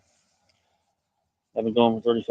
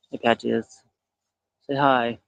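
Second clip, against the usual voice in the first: usual speaking voice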